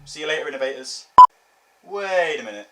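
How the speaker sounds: ordinary talk, nothing marked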